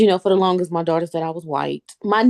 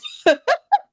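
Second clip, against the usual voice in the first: high, loud giggle; amused high-pitched laughter; 5 dB louder than usual. Real, not acted. Laughs at wild stuff said.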